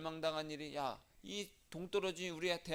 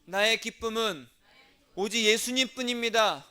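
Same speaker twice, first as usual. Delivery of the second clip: louder, higher, slow proclaiming; loud call to repeat; 4 semitones higher, 10 dB louder than usual. Shown as a demonstration. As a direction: lead the congregation in a loud confession. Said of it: "따라해서".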